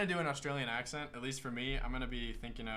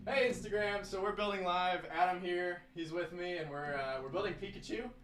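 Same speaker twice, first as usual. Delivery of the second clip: higher, bright on-camera host voice; upbeat Instagram story greeting; social media host; 6 semitones higher than usual. Acted. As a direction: pitch it up for the followers. Addresses Instagram story viewers.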